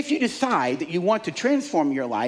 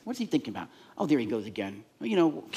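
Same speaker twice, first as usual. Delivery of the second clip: a hint of a muttering skeptic, lower and softer; low, softer aside like a doubting thought; grumbling inner voice; 3 semitones lower, 7 dB quieter, faster than usual. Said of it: "there's a voice in your head that goes".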